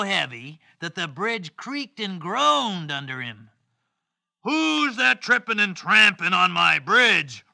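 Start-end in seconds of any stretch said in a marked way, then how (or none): 4.4-7.5 loud storybook troll challenge; big, loud troll voice; troll's roar. Acted. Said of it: "roared the troll".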